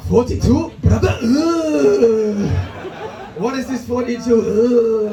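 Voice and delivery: slow, disgusted "Brother, ugh"; TikTok meme guy. Retold or imitated. Crowd judging his age like the TikTok meme guy, slow disgusted scoff. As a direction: scoff like the meme, drawn out in disbelief.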